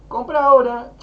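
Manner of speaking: high, loud hard sell; mock pushy sales shout. Mocking pushy sales pitch. (grito burlón de vendedor insistente)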